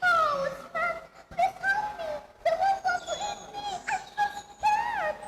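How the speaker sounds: put-on high, pleading child voice; scared pleading. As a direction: beg the woodsman to save her. Girl begs woodsman for help.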